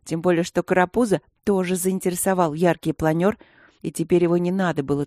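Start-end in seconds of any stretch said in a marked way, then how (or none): none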